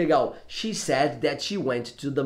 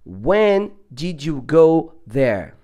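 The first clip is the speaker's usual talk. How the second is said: very slow, louder English practice; careful pronunciation; 6 dB louder, much slower than usual. Shown as a demonstration. Teacher testing his pronunciation on the app, very slow and careful. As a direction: say it word by word, stretched out. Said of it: "melhorando a nossa pronúncia".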